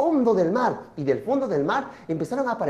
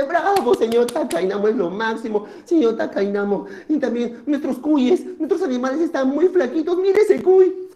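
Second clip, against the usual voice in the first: cheering villagers, climbing to a high plea; townspeople; 4 semitones higher, 3 dB louder than usual. Acted. Villagers show Takainamo their skinny guinea pigs, rising excitedly. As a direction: start with a cheer, end high and agitated. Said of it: "y la gente".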